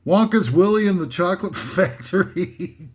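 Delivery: title breaking into a laugh; laughing at own joke. Real, not acted. Comic reading out parody film titles, laughing at himself. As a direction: crack up toward the last word. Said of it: "I'm laughing at myself".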